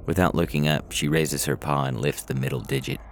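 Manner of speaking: usual speaking voice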